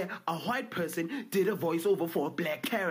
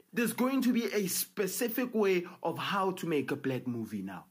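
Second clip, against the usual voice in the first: ordinary delivery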